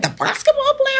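high-pitched incredulous question; astonished reaction. Retold or imitated. Athlete retelling a star sighting, incredulous. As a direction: squeak it out in retold disbelief.

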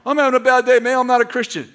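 mock complainer. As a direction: poke fun at the gloomy guy. Mimicking a grumbling person.

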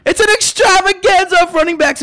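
high, loud hype announcer. Acted. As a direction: announce it like a big show. Hyped show opener.

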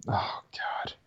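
breathless whispered dismay; a real startled whisper; whispered oh god. Real, not acted. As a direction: whisper it under your breath. Reacting to something on screen.

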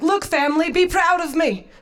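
high, eager mock-proud announcement; proud showing off. Showing shell structure to family at dinner, proud and high. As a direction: call out for the family to admire you.

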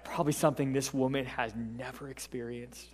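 normal talking voice

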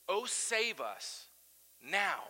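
the word now hit hard; stress on now. Shown as a demonstration. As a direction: land on now, urgent. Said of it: "Not yesterday, not tomorrow".

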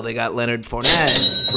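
ordinary talk, nothing marked

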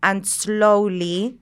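slow, drawn-out words for a listener with another accent; deliberately slowed-down speech; slow talk. Shown as a demonstration. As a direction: talk slowly, stretching each word out. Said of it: "talk like this and slowly".

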